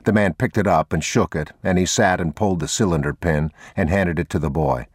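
plain reading voice

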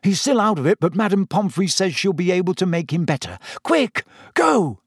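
Harry urging Sirius to flee; urgent Harry. Acted. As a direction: reassure fast, then shove him off. Harry's voiced lines.

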